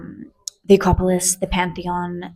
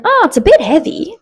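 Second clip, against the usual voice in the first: mock attendant voice; 11 semitones higher, 6 dB louder than usual. Flight attendant quoted at check-in.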